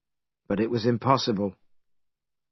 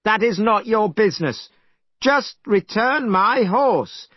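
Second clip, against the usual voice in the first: angry demand; 9 semitones higher than usual. Acted. Monkey threatens dragon.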